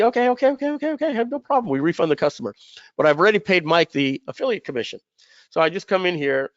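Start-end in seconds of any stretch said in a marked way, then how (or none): 0.0-1.7 higher, fast string of okays to calm someone down; hurried placating. Acted. Seller hastily appeasing the angry customer, fast and higher. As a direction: rush to give in, eager to end the fight.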